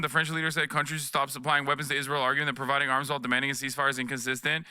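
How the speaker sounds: ordinary delivery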